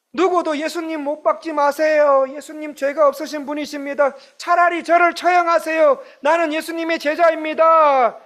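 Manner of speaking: high, shouting plea; the disciple who should have stood up, shouting; bold declaration. Pretend. Voices the brave disciple never heard.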